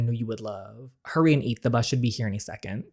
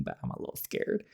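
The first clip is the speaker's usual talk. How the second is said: whisper; 14 dB quieter than usual. Real, not acted. Admits fear before meeting boss.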